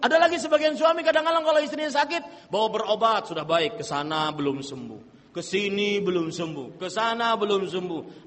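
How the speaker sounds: usual speaking voice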